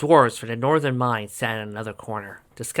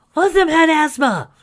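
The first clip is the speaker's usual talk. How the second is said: very high, loud and thin; high squeaky voice; squeaky Sunny voice; 9 dB louder than usual. Acted. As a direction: squeak it out like a cartoon pony. Sunny's excited quote, squeaky voice.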